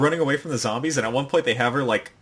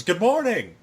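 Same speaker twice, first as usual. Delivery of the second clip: mock villain greeting; 7 semitones higher, 3 dB louder than usual. Voicing anime villain.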